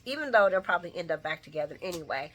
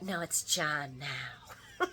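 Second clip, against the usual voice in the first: soft, very slow and laughing; low mumbled correction; 4 semitones lower, 10 dB quieter, much slower than usual. Retold or imitated. [name] correcting [name] on his name, quiet and amused. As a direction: drawl it through a chuckle. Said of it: "He's like".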